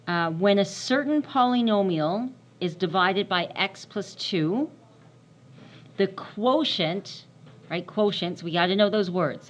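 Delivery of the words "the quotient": "the quotient" slow and weighted; stress on quotient. Shown as a demonstration. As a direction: spell out "quotient" slowly for the class. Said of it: "trying to emphasize the words".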